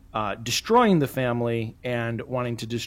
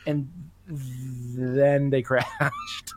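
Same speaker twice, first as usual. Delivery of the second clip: slow punchline; 4 dB quieter, much slower than usual. Real, not acted. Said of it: "punchline, dragged out".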